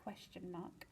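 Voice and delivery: very soft deadpan aside; quiet query. A narrator's reading. Colin questions dithering Mister Penguin, hushed. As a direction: drop right down for a small query.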